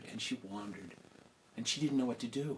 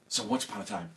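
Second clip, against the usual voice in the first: storybook opening; 10 semitones higher, faster than usual. A narrator's reading. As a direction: open the tale like a storyteller to children.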